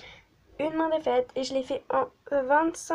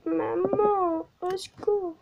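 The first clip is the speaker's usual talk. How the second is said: put-on higher, whiny child calling mum; hammed-up cry for help; mock plea; 7 semitones higher, slower than usual. (appel à maman)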